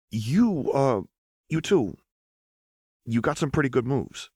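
Echo, awkward and hesitant. Acted. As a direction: stumble through the compliment.

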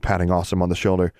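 ordinary delivery

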